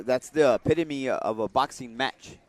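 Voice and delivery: usual speaking voice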